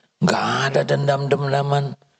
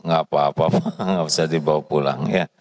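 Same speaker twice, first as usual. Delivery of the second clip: ordinary talk, nothing marked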